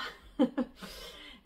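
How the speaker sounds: short real laugh; self-mocking laugh. Real, not acted. Vlogger mocking her own accent to viewers, amused laugh. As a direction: laugh briefly at yourself.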